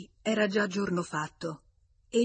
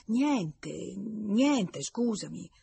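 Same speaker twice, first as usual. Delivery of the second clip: higher, flustered mumbling apology; confused stammer; 4 semitones higher, much slower than usual. A narrator's reading. Confused visitor answering his angry friend, flustered stammer. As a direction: stammer it out, thrown off balance. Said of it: "si mise a barbugliare".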